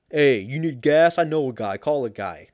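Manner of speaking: put-on Italian-American mob voice, loud at first; Italian mobster accent. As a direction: do a mock Italian wise guy. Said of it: "automatically went to Italian".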